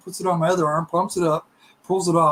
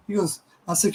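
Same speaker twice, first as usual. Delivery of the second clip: ordinary delivery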